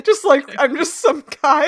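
high, loud laughing speech; cracking up mid-sentence; laughing hard. Real, not acted. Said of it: "You're just losing your shit right now".

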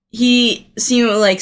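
ordinary delivery

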